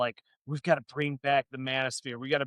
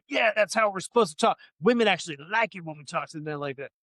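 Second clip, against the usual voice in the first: mock fan dude; 2 semitones higher than usual. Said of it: "all these dudes that listen to it and go".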